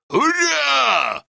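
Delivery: high, held victory cry; battle shout. A narrator's reading. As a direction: raise the flag and shout it out. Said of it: "krzyknął Bartek". (Bartek krzyczy zwycięsko, podnosząc chorągiew)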